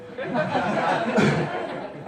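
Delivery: loud laughter. Real, not acted. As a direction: burst of high, loud laughter. Laughing at Trevor hole joke.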